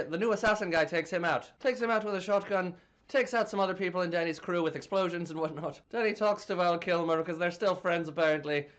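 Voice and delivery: hammed-up Irish storyteller; playful stage-Irish brogue; put-on Irish accent. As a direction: review the film in a mock Irish accent.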